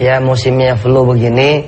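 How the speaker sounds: ordinary talk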